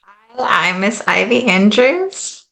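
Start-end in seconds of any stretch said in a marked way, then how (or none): none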